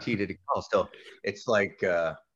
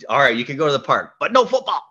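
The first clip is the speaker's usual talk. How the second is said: mock stern Tita; 4 semitones higher, 9 dB louder, faster than usual. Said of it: "she said".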